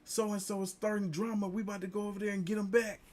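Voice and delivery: mock hyped-up dad, high and fast at the end; excited dad rallying for a water fight. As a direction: egg on the crew like a hyped dad. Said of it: "he's like".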